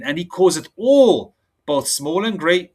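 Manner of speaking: slow, emphatic preaching read; preacher booming out a verse; scripture reading. Acted. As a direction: lean hard into the verse. Reads Revelation, emphatic.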